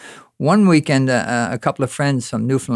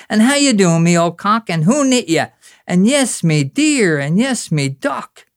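folksy slang greetings; 8 semitones higher, 4 dB louder than usual. Acted. Acts out Newfoundland slang greetings.

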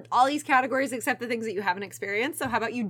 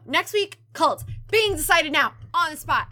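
loud, hyped trailer announcer; announcer hype; 4 semitones higher, 6 dB louder than usual. Acted. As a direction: sell it like a promo.

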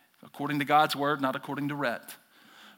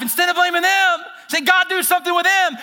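much higher and louder; mock prayer complaint; 12 semitones higher, 8 dB louder than usual. Pastor voicing a complaining prayer, loud and high. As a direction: cry out to God about them. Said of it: "While we're all saying".

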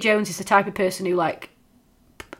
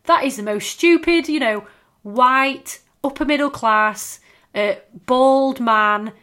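higher and louder, righteous scorn; indignant speech; 4 semitones higher, 6 dB louder, slower than usual. Retold or imitated. Bridget telling off posh lawyers, indignant. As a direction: call out the posh lawyers to their faces. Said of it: "she comes out with this amazing speech, like".

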